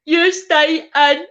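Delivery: high, loud, slow mocking cackle of a pal. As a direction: squeal it out high and loud, delighted. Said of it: "she's like".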